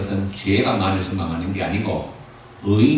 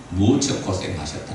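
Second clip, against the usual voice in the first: ordinary talk, nothing marked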